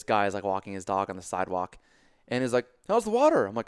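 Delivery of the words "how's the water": much higher, louder friendly call; chirpy passer-by. Retold or imitated. Dog walker greeting the limping swimmer, cheerily oblivious. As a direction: imitate an oblivious dog walker calling over.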